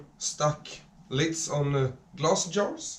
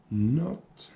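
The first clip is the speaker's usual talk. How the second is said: whispering; 4 dB quieter than usual. Real, not acted. Whispered while gluing.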